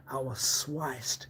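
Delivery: slow, then a whispered echo of sliced; slow grim whisper. Acted. Describing his wound, slow hushed whisper. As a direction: let sliced fade to a breath.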